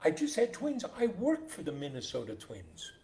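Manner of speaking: lightly acted delight, starting high; happy amazement. New father tells nurse about his team, happily amazed. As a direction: marvel at a happy coincidence. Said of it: "The man is so happy".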